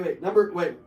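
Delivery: ordinary delivery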